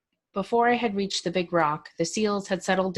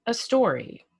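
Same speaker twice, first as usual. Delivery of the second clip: higher "a story?" falling in disbelief; incredulous question; 5 semitones higher than usual. Acted. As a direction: end "a story?" with a falling tune. Nephew incredulous on the phone.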